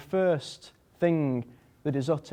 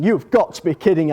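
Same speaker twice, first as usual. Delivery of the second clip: louder, raised voice of disbelief; mock-panicked protest; acted alarm; 4 semitones higher, 8 dB louder than usual. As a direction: play racing-heart alarm, loudly. Panicked thought on seeing adopter.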